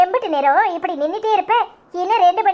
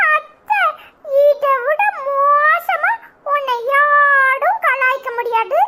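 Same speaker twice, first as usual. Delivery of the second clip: much higher, slow hammed-up wail; mock crying; 10 semitones higher, much slower than usual. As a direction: whine to auntie in pretend tears. Mock-wailing after being teased. (பொய்யாக அழுது புலம்பும் குரல்)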